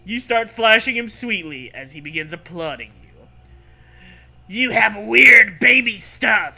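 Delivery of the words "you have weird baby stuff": loud, slow, higher exclamation; hammed-up loud exclaim. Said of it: "he exclaims over and over".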